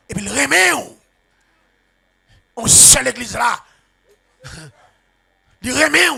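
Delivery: high, loud shouted preaching; preaching at full force. Acted. Preacher shouting the story of David's sin at the congregation. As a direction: shout it out over the church.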